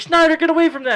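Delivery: high, loud yell at the dog; freaking out. Retold or imitated. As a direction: imitate a cousin frantically calling off his dog. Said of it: "my cousin starts freaking out".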